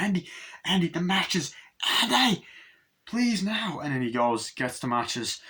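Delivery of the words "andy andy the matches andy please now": high, imitated desperate calling; urgent plea. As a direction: beg urgently, pitched up. Acted plea for the matches.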